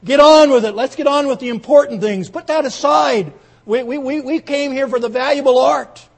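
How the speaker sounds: furious bidder yelling to hurry up; impatient shout. Acted. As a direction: shout him down, demanding the real art. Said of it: "someone cried".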